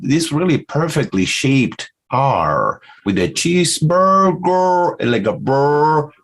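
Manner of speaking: "burger" with a long drawn-out R; high, slow demo of the R; stretched R. Shown as a demonstration. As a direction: hold the R in "burger" to show it. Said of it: "I'm extending it to demonstrate".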